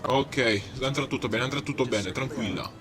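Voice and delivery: soft, calming reassurance; reassuring. Pretend. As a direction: soothe them gently. Calming a passenger.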